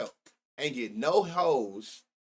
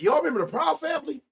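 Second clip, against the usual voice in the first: much higher and louder, a sudden fast burst; excited shout; 12 semitones higher, 5 dB louder, faster than usual. Real, not acted. To the chat, recalling a childhood cartoon, an excited shout. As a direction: blurt it out, thrilled to remember.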